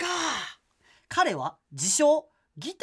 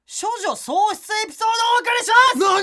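loud announcement; 11 semitones higher, 8 dB louder, faster than usual. Acted. Announcing the letter's big reveal.

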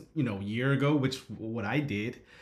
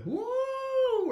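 high falsetto whoop; imitated crowd whoop. Host mimics congregation cheering, high whoop. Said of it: "you hear the".

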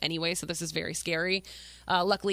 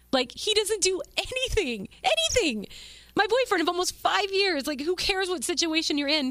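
upset, rising outrage; 10 semitones higher than usual. Real, not acted. Telling viewers how her boyfriend ignored her, high indignant outrage. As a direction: voice climbing in disbelief at him. Ranting about unsupportive boyfriend.

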